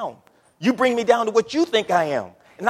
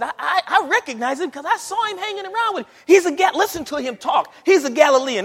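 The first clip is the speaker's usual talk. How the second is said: higher, laughing young woman's voice, only lightly voiced; young lady accusing; 7 semitones higher, 3 dB louder than usual. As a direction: play the girl who points at Peter, half amused. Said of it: "the young lady said".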